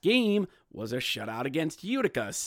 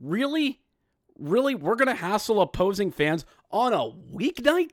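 high, disbelieving really; very high incredulous question; 6 semitones higher than usual. Real, not acted. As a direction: ask in disbelief. Incredulous at hassling.

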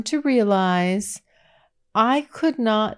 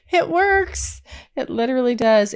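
laughing; 6 semitones higher than usual. Real, not acted. Laughing as she admits his charm works on her. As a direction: laugh through the admission.

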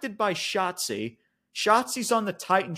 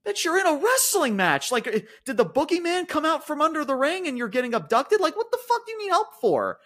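raised, incredulous scolding; exasperated retort; 7 semitones higher than usual. Retold or imitated. Answering Io Sky's cry for help, mock exasperated scold. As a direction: talk down to her in disbelief. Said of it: "I'm like".

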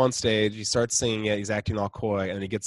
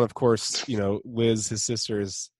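ordinary talk, nothing marked

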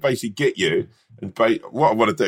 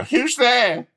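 much higher, scared mock squeak; 12 semitones higher than usual. Hiding friend answering the knock, high scared squeak. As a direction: squeak it like a frightened kid. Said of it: "And you'll go".

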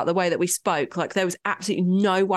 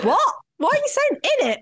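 shocked mock reaction; 3 dB louder than usual. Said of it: "I was like".

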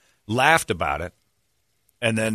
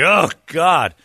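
higher and louder disgusted oh God; playfully appalled exclamation; mock groan; 7 semitones higher, 6 dB louder than usual. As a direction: recoil at the suggestion. Retold reaction to boss.

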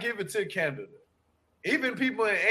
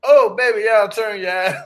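louder mock-grandma outcry; grandma exclaiming; 8 dB louder than usual. Grandma warning the others to turn away, exclaiming loudly. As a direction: burst out loud as a startled grandma. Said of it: "my grandma was like".

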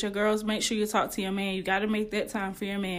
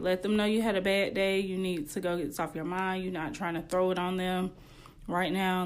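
normal talking voice

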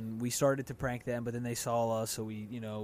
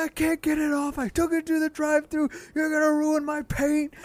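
mock furious yelling; 13 semitones higher, 6 dB louder than usual. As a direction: ham up the raging youth leader. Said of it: "She's like".

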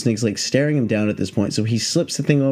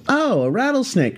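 higher-pitched, breezy handler voice; unbothered snake handler; 11 semitones higher than usual. Retold or imitated. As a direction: imitate a pro who finds this routine.

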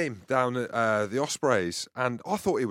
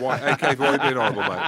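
laughing; 6 dB louder than usual. Real, not acted. Laughing complaint.

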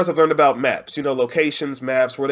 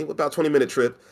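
normal talking voice

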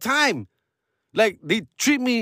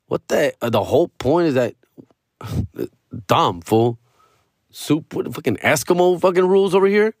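ordinary delivery

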